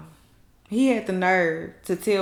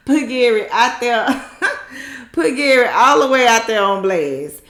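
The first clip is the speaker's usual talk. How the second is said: loud, laughing delight; real laughter, loud; 4 semitones higher, 7 dB louder than usual. Real, not acted. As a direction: crack up while saying it. Laughing while telling drama.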